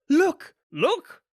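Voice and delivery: high, loud excited shout. A narrator's reading. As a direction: shout it out in amazement. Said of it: "both of them shouted".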